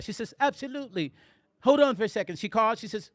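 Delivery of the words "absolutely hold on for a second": imitated British lady, agreeing readily; British accent. Woman agreeing to make the introduction, mock British accent. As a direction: put on her British accent. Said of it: "she had a British accent".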